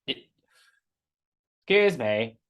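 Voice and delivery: drawn-out, lightly posh gnome; slow, stretched posh gnome voice. Acted. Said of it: "posh gnome voice".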